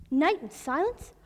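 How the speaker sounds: usual speaking voice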